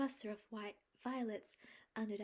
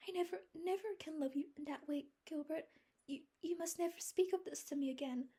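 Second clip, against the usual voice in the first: higher, faster, pleading insistence; desperate; 10 semitones higher, 5 dB louder than usual. A narrator's reading. Anne forbidding Gilbert to speak of love, desperate. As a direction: press it out in a rush. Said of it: "exclaimed Anne desperately".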